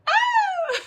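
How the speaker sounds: very high, loud mock squeal; hammed-up high "oh" shriek; imitated cartoon squeal. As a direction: imitate an excitable cartoon friend's cry. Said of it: "she's just got that".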